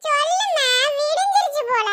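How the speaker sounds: put-on high, slow, drawn-out kid's question; a child pestering mom about arrival; eager child. Sleepy child asking mother. (அம்மாவிடம் ஊருக்கு வந்தாச்சா என்று நீட்டிக் கேட்கும் குழந்தை)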